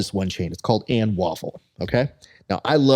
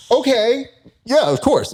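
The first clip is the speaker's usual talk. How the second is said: mock eager; 13 semitones higher, 4 dB louder than usual. Mock eager agreement to friends.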